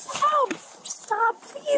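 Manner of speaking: high desperate cries of stop; panicked pleading. Real, not acted. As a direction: beg him to stop in panic. Arrest struggle, begging officer.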